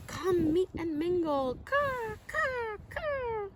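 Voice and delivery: high, cawing bird voice; crow calling. Acted. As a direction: call out like a crow inviting friends. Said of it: "The crow calls out".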